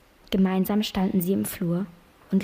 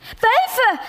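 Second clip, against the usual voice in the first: high, loud cry of "Wölfe!"; shrill alarm at the wolves; panicked cry; 9 dB louder than usual. Acted. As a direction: cry it out as a terrified mother on the stairs. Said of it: "rief sie".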